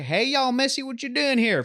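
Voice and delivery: friendly southern guy. Retold or imitated. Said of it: "That same guy would, to you, would be like".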